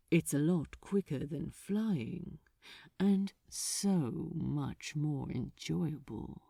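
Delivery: lightly voiced, silky demon; smooth archdemon voice. A narrator's reading.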